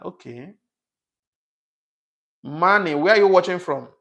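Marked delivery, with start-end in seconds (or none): 2.4-3.9 loud, raised-pitch call to a viewer. Real, not acted. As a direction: call out loudly for an answer. Calling to a named live viewer.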